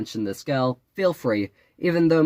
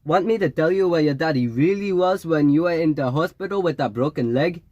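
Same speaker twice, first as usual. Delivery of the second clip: put-on higher Jamaican-accented taunt; taunting fake Jamaican; 5 semitones higher than usual. A narrator's reading. Fake Jamaican to the narrator's back, taunting in his accented voice. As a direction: needle him with a mystic's secret knowledge.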